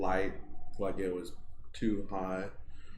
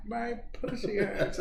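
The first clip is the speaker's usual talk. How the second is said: put-on high, slow old lady voice; deadpan granny drop; 12 semitones higher than usual. Granny voice in the prank.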